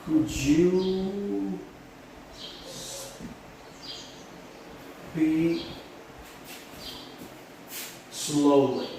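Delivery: very slow, long gaps between words; spaced-out teacher dictation. Shown as a demonstration. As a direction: draw out each word as if writing it down. Dictates word by word while writing.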